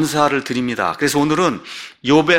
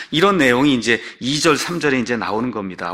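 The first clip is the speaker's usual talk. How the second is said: ordinary talk, nothing marked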